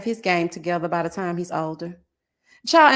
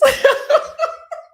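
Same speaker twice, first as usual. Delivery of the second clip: loud, high, breathy laughter; 6 dB louder than usual. Real, not acted. Laughing at own story.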